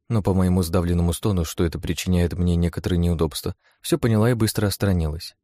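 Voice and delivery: ordinary delivery, nothing marked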